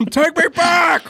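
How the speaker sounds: high-pitched pleading yell; mock desperate shout. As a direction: cry out to be taken back, hammed-up. Voicing man turning Brazilian bug.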